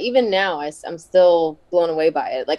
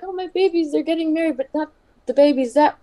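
higher, doting fatherly delight; fond; 9 semitones higher than usual. Retold or imitated. Mentor gushing over his wrestlers marrying, doting. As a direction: gush like a proud father. Hunter happy about the marriage.

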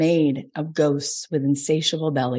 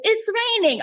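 much higher, whiny mock alarm at a drizzle; mocking LA people. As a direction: squeak it like a spoiled Angeleno. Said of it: "L A people are like".

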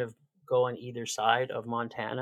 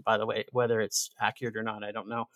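usual speaking voice

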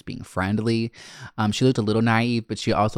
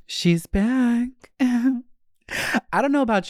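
much higher, showy sing-song; diva announcement; 12 semitones higher than usual. Acted. Back from the break to her listeners, a high diva announcement. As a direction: announce your own return like a star.

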